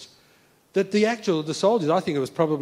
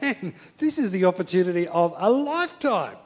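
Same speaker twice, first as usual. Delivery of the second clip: put-on thrilled young hopeful; 5 semitones higher than usual. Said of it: "instead of feeling discouraged".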